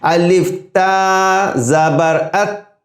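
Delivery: الف تا زبر ات drawn out, light natural ت; slow demo of ت. Shown as a demonstration. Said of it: "یہ نیچرل آواز ہے". (ہلکی ت کا آہستہ تلفظ)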